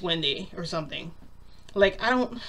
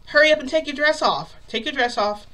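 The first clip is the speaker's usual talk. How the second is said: louder, higher hurried command; impatient order; 8 semitones higher, 7 dB louder than usual. Retold or imitated. He wants her soaked clothes off, impatient command. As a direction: order it urgently, voice raised.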